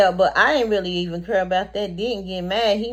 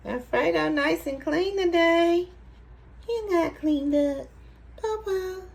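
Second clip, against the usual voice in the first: pet talk; 7 semitones higher than usual. Real, not acted. Owner admires Alfredo nice and clean, high baby-talk. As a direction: coo proudly in a high little voice.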